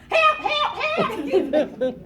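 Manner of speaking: high, loud comic cries for help; retold screaming for help. Storyteller calling past an unhelpful girl, hammed-up loud screaming. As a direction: yell frantically, hamming it up. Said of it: "So I started screaming".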